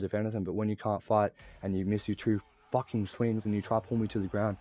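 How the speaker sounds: ordinary delivery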